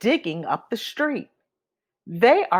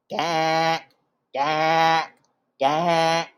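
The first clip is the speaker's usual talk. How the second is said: slightly lower, drawn-out "gank"; big duck quack; 3 semitones lower, much slower than usual. A narrator's reading.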